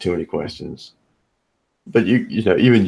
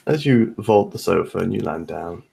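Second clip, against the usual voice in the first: usual speaking voice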